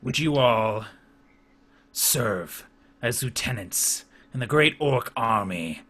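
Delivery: slow, stately warlord proclamation; solemn orc chieftain. Acted. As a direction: bestow a great honor with weighty pauses. Chieftain offers lieutenancy.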